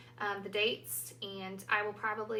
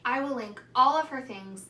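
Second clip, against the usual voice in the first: normal talking voice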